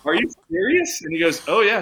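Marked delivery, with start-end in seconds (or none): 0.0-1.0 high-pitched incredulous question; mock-amazed, pitch jumping up; retold disbelief. Retold disbelief at friend's revelation. 1.5-1.8 higher, louder quoted assurance; punchy reenacted affirmation; retold emphatic confirmation.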